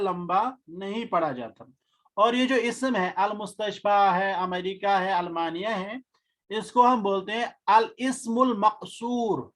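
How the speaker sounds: ordinary talk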